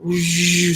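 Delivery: a high, rushing gust of wind, imitated; wind whoosh. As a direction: mimic a sudden gust sweeping past, then cut it off. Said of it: "it would just be like".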